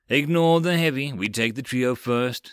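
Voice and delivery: plain reading voice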